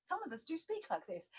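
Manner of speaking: high, hammed-up posh English voice; mock-posh reply, high and soft; ridiculous posh accent. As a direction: overdo the plummy accent. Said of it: "I was being ridiculous".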